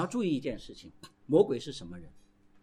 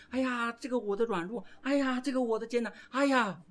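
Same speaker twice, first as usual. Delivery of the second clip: higher, sighing whine over the weakness; mock self-pity; 7 semitones higher than usual. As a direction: lament it in a caricature. Mocking self-pitying believers. (模仿自怜的叹息腔调)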